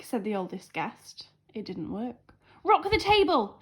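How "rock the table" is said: higher, louder call; shouted. A narrator's reading. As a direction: twin guests shouting a suggestion. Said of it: "shouted the twin guests".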